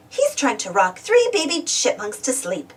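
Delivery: ordinary delivery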